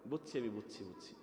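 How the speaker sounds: put-on a cleric teasing the boy, dry and knowing; dry sarcasm. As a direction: say it fast and wry, then trail off softly. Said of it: "আমি তখন বলছি".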